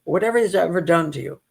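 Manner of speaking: normal talking voice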